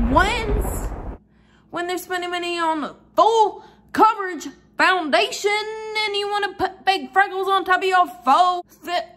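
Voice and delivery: high, loud outraged disbelief; sassy outrage at fake freckles; indignant. Real, not acted. Indignant rant about fake freckles.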